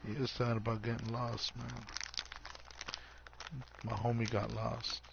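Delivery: usual speaking voice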